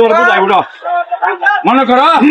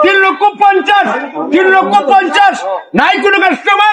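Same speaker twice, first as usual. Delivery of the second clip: auctioneer; 4 semitones higher than usual. Acted. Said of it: "গাড়ি বিক্রি হবে নিলামে".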